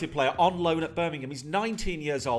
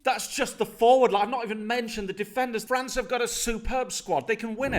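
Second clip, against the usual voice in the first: higher, louder hyped listing; passionate; 7 semitones higher, 4 dB louder than usual. Real, not acted. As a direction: sell the squad with passion. Defending France passionately.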